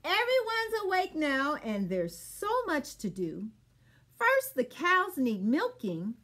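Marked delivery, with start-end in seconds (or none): none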